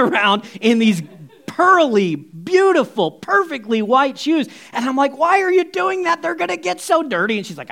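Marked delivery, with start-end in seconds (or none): 5.2-7.4 high-pitched, fretting disbelief; incredulous protest. Retold or imitated. Voices own dismay at shoes.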